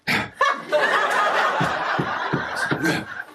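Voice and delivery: a long laugh that rises high, then falls; real laughter; high falling laugh. Real, not acted. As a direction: laugh until the breath runs out. Laughing after his confusion.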